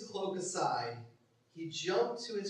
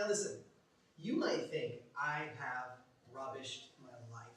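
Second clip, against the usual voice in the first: usual speaking voice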